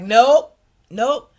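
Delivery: a loud, rising "nope" twice; turning down the agency's call, loud; loud refusal. Retold or imitated. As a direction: shut them down, loud and flat-out. Retold refusal to return.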